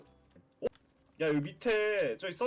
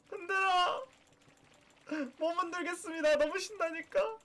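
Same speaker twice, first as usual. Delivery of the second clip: excited; 10 semitones higher than usual. Pretend. Birthday party excitement.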